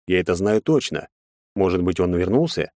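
ordinary delivery

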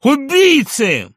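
patient beating himself against the door; desperate scream. Acted. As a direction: shriek in despair. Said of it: "навалился на дверь".

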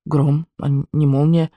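slow, halting meek repeat; submissive. A narrator's reading. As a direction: echo it obediently, unsure. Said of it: "покорно повторил Петя".

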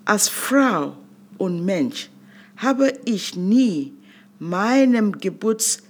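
ordinary delivery

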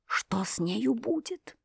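slow, breathless whispered thought; a frightened inner whisper; anxious whisper. A narrator's reading. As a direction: whisper it to yourself in fear.

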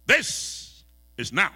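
loud, slow, high proclamation; Adam's awed loud cry; exclaiming loudly. Acted. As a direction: cry it out in wonder. Preacher quoting Adam, drawn out.

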